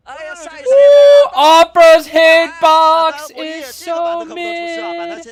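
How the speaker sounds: real frustrated shout. Real, not acted. Caster yells at the game over a hitbox, real frustrated shout. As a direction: yell it out slowly in disbelief.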